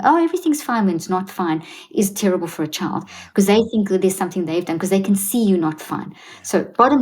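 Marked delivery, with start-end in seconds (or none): 0.1-0.9 fake cheerful.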